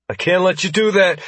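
high, nervous guard; trembling voice. A narrator's reading. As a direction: stand up to him while frightened. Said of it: "he said, voice trembling".

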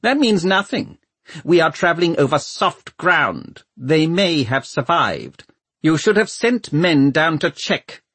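high-pitched, cold Lord Mayor; cold, thin voice. A narrator's reading. Lord Mayor rebuking Valentine for not checking, cold and thin. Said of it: "cold, thin voice of the Lord Mayor".

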